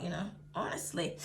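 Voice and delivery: breathy unvoiced whisper; embarrassed whispered honestly; whispered aside. Real, not acted. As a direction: mutter it to yourself under your breath. Aside after the coochie remark.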